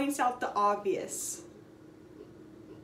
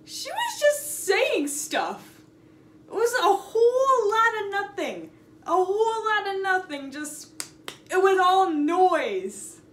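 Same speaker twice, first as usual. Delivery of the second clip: exasperated; 6 semitones higher, 4 dB louder than usual. Real, not acted. Exasperated rant about noise.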